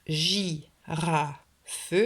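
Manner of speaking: GI, RA, FE in three slow beats; "girafe" slowly, pausing inside the word; split into syllables. Shown as a demonstration. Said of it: "Répétez bien chaque syllabe".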